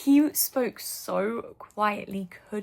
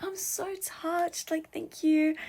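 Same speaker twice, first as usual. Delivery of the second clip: imitating touched; 8 semitones higher, slower than usual. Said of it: "he was like".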